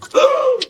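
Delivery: sharp high gasp; shocked gasp. Acted. Stunned by the answer, a sharp high gasp.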